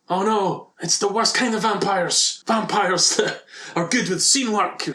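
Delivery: a hint of a horror-movie victim; mock alarm. Joking about theatre vampires arriving, hammed-up mock panic.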